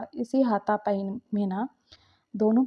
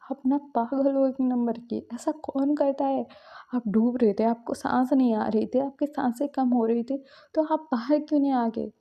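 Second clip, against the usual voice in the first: higher, louder pained reproach; tearful angry scolding; 4 semitones higher, 3 dB louder than usual. Acted. Shinchan scolds Suyang for not coming up, shaken and angry. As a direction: scold him, still shaken.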